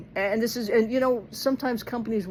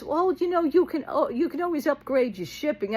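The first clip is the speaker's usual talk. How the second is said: mock sweet agent; 8 semitones higher than usual. Retelling the agent's upsell suggestion.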